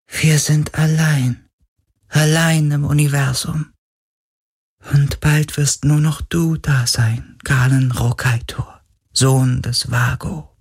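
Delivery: high, slow, fading to a whisper; a spirit facing its end alone; lonely ghost. Acted. Ghost tells Galen he will be the last, high and fading.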